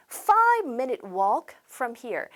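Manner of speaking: slow, evenly spaced English phrase; model reading. Shown as a demonstration. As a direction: repeat it slowly for the class. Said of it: "이렇게 발음을 하셔야 되고".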